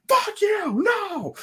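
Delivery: high, strained mock shout; audience shout. Angry fan in the theater shouting at the screen, high mock shout. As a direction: yell it like an angry fan in the seats. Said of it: "somebody in the theater was like".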